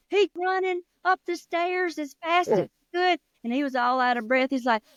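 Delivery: usual speaking voice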